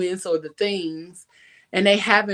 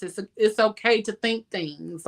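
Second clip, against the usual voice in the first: ordinary talk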